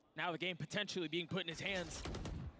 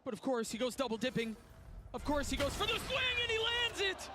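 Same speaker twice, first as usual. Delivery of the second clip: excited shout; 10 semitones higher than usual. Real, not acted. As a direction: call the clutch like a caster. Caster calling a clutch play.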